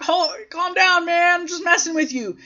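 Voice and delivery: high-pitched mock placating; placating friend. Acting friend placating the angry wrestler.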